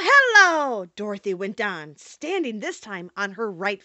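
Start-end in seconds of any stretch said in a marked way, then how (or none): 0.1-0.8 HELLO chanted high and loud; magic incantation. A narrator's reading. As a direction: call out a drawn-out hello as a spell word. Dorothy recites the magic charm.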